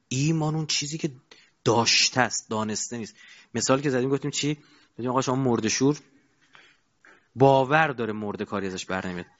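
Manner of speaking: ordinary talk, nothing marked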